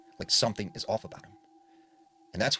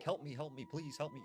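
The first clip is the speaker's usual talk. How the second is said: higher-pitched mock scream, kept soft; urgent retold plea, pitched up; 6 semitones higher, 9 dB quieter than usual. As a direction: imitate screaming for help without the volume. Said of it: "he started screaming again".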